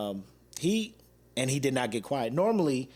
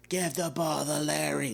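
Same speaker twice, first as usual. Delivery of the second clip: slow, lightly voiced drunk shout; mock the drunk fan's demand; imitating a drunk yell; 3 semitones higher, slower than usual. Drunk fan yelling for Larry, mild mock shout. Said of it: "He was just yelling".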